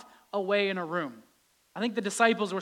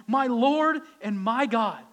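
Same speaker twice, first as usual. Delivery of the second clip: fervent confession; 4 semitones higher, 7 dB louder, slower than usual. Acted. Response like Thomas.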